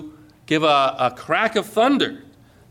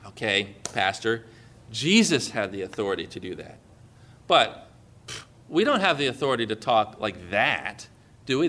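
doubtful objector; 8 semitones lower, 4 dB quieter than usual. Pretend. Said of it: "But you might say".

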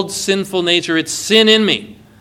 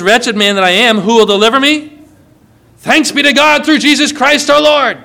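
louder, rising to a high triumphant thanks; joyful proclamation; 5 semitones higher, 4 dB louder than usual. Retold or imitated. Pastor proclaiming Paul's rescue to the congregation, exultant. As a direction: swell up with rejoicing on the thanks. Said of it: "Paul says".

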